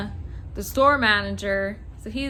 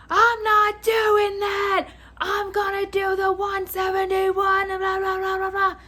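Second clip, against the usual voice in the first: high, loud imitation of an irate customer; mock angry yelling; 8 semitones higher, 3 dB louder than usual. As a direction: mimic a caller losing it, pitched way up. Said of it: "he's losing his shit at me".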